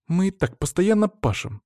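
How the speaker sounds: high-pitched complaint; complaining. A narrator's reading. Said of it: "добавила Вероника".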